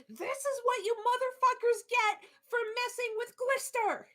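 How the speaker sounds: high, full-throated defiant shout; battle shout. Acted. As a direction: bellow it at the enemy in triumph. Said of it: "And shouts".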